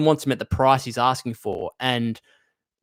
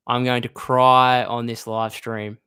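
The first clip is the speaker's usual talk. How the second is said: slow, each word weighed, louder; slow emphatic repeat; 4 dB louder, slower than usual. Real, not acted. Fan dreading a trade on his stream, slow and emphatic. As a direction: drag it out as a mock lament. Upset over trade rumour.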